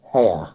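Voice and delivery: "hair" with no R, said the received pronunciation way; posh hair. Retold or imitated. Speaker mimicking an advert's posh accent, "hair" with no R. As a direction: say "hair" dropping the R like a hair-product advert. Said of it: "the way they say".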